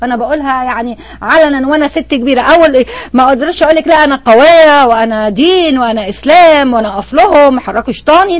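ordinary talk, nothing marked

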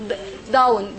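ordinary talk

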